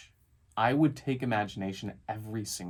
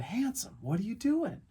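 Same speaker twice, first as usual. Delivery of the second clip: doting parent talk; 10 semitones higher than usual. Retold or imitated. Dad trying not to laugh asks his son, high doting voice. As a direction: gently ask a small child, pitched up.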